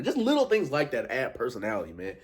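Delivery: usual speaking voice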